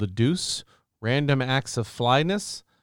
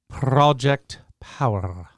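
slow, rolling the R in "Project Power"; rolled Spanish R's; much slower than usual. Shown as a demonstration. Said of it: "Like how I rolled my R's there?".

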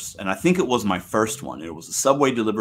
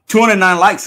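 excited; 10 semitones higher, 10 dB louder than usual. Real, not acted. Streamer hyped reading like count.

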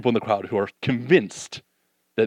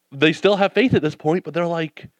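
normal talking voice

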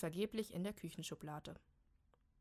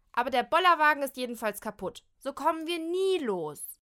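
higher, louder complaint; shrill complaint; 7 semitones higher, 12 dB louder than usual. Acted. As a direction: rant about the broken cart. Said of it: "zetert Kim". (zeternd klagen)

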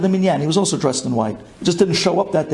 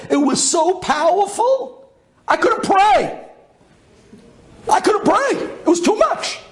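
overwhelmed awe; 14 semitones higher than usual. Retold or imitated. Overwhelmed by sunrise light.

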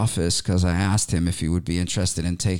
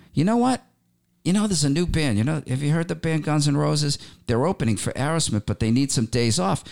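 imitating the manager, pitched much higher and eager; retold excited manager; 7 semitones higher than usual. Manager offering the opening slot, in a higher, eager voice. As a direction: lift into a higher, keyed-up voice for his pitch.

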